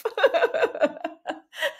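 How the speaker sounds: high squealing laugh; embarrassed laugh. Real, not acted. Remembering her first video, an embarrassed squealing laugh. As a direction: laugh at your younger self, cringing.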